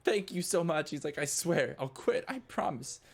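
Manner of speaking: high, loud tearful gratitude; crying thanks. Acted. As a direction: sob out the thanks. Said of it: "starts crying".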